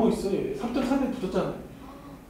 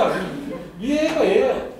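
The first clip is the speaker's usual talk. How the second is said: ordinary talk, nothing marked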